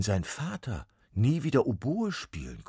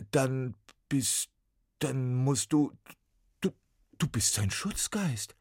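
put-on higher, louder boy blurting it out; tumbling words; 8 semitones higher, 7 dB louder than usual. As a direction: let the words tumble out as Max. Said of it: "die Worte purzelten aus Max' Mund".

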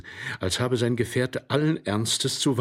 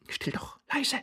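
soft urgent whisper; whispered warning to keep quiet; urgent hushing; 13 semitones higher, 6 dB quieter than usual. Acted. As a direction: hush them under your breath. Hushing the other while listening.

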